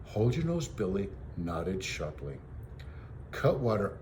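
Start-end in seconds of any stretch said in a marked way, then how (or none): none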